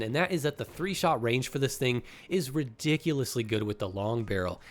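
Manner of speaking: ordinary talk, nothing marked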